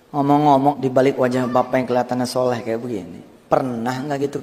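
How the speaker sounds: ordinary talk, nothing marked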